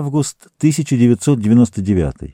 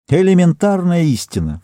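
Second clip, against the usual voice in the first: snorted, higher, scornful; snorting retort; 7 semitones higher than usual. A narrator's reading. Woman snorts at the captain's question, scornful. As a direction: scoff at the obvious. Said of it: "фыркнула она".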